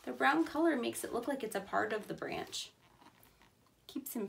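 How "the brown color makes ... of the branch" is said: normal talking voice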